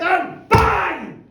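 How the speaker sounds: loud high crash word; bang. Acted. Narrator giving the crash, loud bang. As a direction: slam the crash out.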